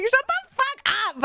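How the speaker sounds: high, laughing shut-up; laughing mock outrage. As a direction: fake outrage at the teasing. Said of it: "I was like".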